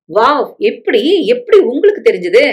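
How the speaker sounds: stretched-out wow of surprise; amazed. Acted. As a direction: react in delighted astonishment. Amazed man leaps up at news.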